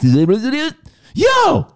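high, loud mock "Yo!"; mocking imitation. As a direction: throw his "Yo!" back at him, mocking. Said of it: "mocking Rich's "yo".

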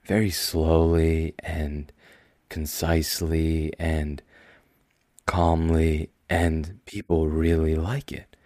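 very slow, measured and calm; unhurried and deliberate, each word placed; slow calm talk. Shown as a demonstration. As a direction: show them your slow soothing podcast pace. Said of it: "I talk like this".

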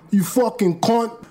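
English accent. Shown as a demonstration. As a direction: swear in a mock English accent. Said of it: "say it in an English accent".

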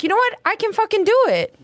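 high, defiant burst of self-belief; fired-up self-talk. Retold or imitated. To himself after the pep talk, high and fired up. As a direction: pump yourself up, pitch lifted. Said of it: "and then I was like".

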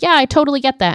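high, bright-eyed mock agreement; perky oblivious friend. Kitty oblivious, chatty friend.

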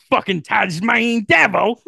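hammed-up Australian, breaking into a laugh; Australian crocodile hunter. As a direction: play an excitable Australian wildlife man. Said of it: "the crocodile hunter".

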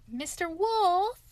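high, slow, loud call. A narrator's reading. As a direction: hail someone, stretching the name. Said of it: "he called".

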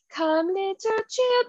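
high, slow, sing-song witch lure; Hocus Pocus witch. Retold or imitated. Imitating the witch singing to the children, high and slow. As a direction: croon it like a witch luring children. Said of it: "Hocus Pocus, like, when she's, like, singing to the children".

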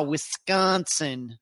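slow, drawn-out upper Midwest accent. Shown as a demonstration. Host showing off to the chat, upper Midwest accent. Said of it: "I can do that in upper Midwest accent".

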